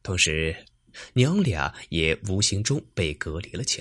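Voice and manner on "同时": usual speaking voice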